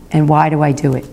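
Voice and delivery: normal talking voice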